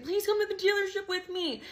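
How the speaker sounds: hammed-up crying on the phone; mock tearful plea. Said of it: "called him in tears, crying".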